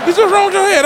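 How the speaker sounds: high, loud disbelieving comeback; incredulous retort. Retold or imitated. Shooting back at a man telling him what to do, incredulous. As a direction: fire it back like he's lost his mind.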